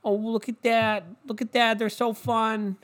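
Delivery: a hint of a giddy TV anchor; mocking SportsCenter. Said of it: "SportsCenter is like".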